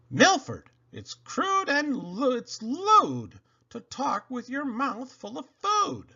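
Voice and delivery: high, scolding mother voice; a mother scolding her son. Acted. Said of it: "His mother said".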